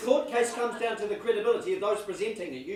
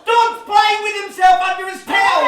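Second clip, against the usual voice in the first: high shout to Mum; loud-mouthed child; 10 semitones higher, 6 dB louder, slower than usual. Acted. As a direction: holler like a tattling kid on holiday.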